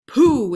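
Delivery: loud contemptuous pooh; scornful tiger snort. A narrator's reading. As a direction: blast it out with disdain. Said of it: "interrupted the tiger".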